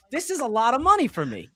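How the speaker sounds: usual speaking voice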